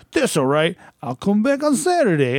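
high, drawn-out mimicry; lightly mocking high voice; Fred. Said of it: "it's usually Fred".

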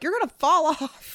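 high, loud mock-fretful mom; worried mom. Imitating her mom yelling a warning from the boat. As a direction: squeal the warning like a panicky mother. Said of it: "my mom would be like".

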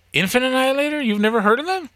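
high, amazed question; surprised. Retold or imitated. Coworker asking about the band, high surprised disbelief. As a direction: act amazed he never heard of them.

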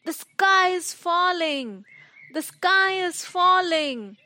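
high, drawn-out cry of alarm; scared shout. Acted. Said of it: "got more scared and shouted".

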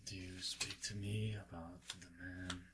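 soft, hushed stranger's voice; whispering. Acted. Kneeling man asks the brothers about the man, whispering. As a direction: ask it softly, half-whispered. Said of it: "you can hear him whispering".

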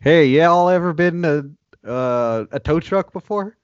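hammed-up country comic voice; Larry the Cable Guy. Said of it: "Larry the Cable Guy's about to enter".

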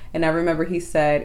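ordinary delivery

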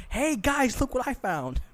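an excited high call to his friends; excited Jack. Retold or imitated.

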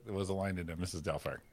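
usual speaking voice

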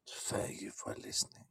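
soft whispered sign-off. Real, not acted. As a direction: breathe it out in a whisper. Signing off to listeners.

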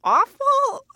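high cry of disbelief; shocked. Acted. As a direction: squeal it out in horror. Mock horror at palace life.

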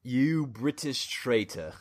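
slow, soft, posh British villain; posh James Bond villain. Acted. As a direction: draw it out like a quiet Bond villain. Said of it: "that was your posh, that was like your James Bond villain one".

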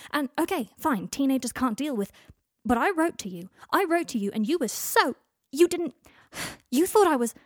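loud and high, words tumbling out; frustrated outburst; heated shout. A narrator's reading. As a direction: shout the hurt, breaking off mid-sentence. Harry shouting his grievance.

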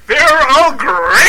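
slow, stretched booming catchphrase; Tony the Tiger voice. Retold or imitated. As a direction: drag it out like a tiger mascot.

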